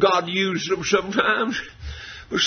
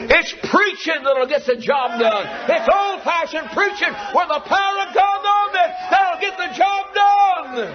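full-force preaching; 11 semitones higher than usual. Real, not acted. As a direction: build the sermon to a shouting peak.

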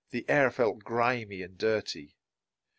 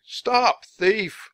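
high, loud crowd cry; yelling; 11 semitones higher, 5 dB louder than usual. Acted. Whole crowd after the children, yelling. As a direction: shout it like a pursuing mob. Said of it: "Everyone was yelling".

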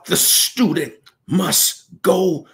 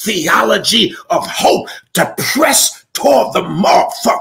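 rising, louder, near a shout; full-force preaching; 2 semitones higher, 3 dB louder than usual. Real, not acted. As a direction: build the whole line to a climax.

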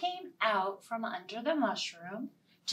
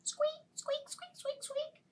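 very high squeaky squeak; mouse squeaks; 4 dB quieter than usual. Acted. Storyteller squeaking as the mouse for children.

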